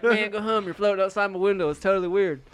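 high, loud exasperated reply; annoyed kid voice. Retold or imitated. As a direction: brush off the visitor. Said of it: "He's like".

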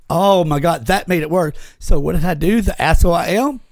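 normal talking voice

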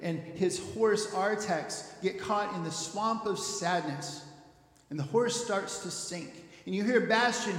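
normal talking voice

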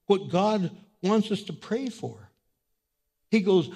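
usual speaking voice

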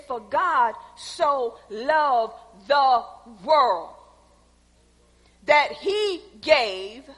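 slow, measured, each phrase set apart; slow scripture reading. Shown as a demonstration. Preacher reading John three sixteen slowly to the church. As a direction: lay the verse out word by word. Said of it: "I'm gonna read it slow".

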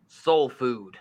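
low southern drawl, teasing; mock southern accent; mimicked southern accent. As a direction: imitate a disapproving southerner. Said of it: "get a southern accent out of nowhere".

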